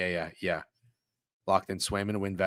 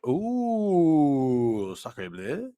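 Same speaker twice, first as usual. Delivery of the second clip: French painter; much slower than usual. Acted. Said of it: "I really do feel like a painter".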